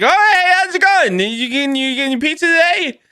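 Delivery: high-voiced chummy busybody; over-friendly pizza-shop regular. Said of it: "I'm like".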